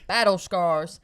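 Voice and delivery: slow, put-on Texas drawl; mock Southern accent. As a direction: drag it out like a Texas soldier. Imitating Jasper's sudden accent.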